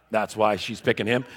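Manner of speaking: normal talking voice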